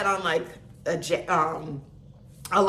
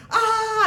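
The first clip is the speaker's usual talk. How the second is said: mock war cry; 12 semitones higher, 3 dB louder than usual. Instructor's war cry retold.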